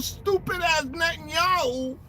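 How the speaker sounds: normal talking voice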